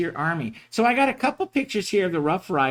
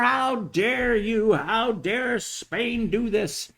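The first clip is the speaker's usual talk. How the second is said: mock outrage; 6 semitones higher, much slower than usual. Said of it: "people are now shaking their fist even more".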